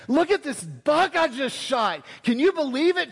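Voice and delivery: high excited braggart voice; boasting hunter. Retold or imitated. David boasting to Troy over the buck, high and excited. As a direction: crow about the kill, pitched high. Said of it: "David says".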